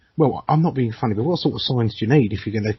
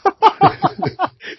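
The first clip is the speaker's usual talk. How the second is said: loud high laugh; high burst of laughter; 5 dB louder than usual. Real, not acted. Laughing at racist joke.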